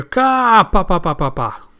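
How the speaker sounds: high, loud "ka" falling into staccato "pa"s; cartoonish laugh trailing downward; odd cackle. Imitating Kawamatsu's strange laugh.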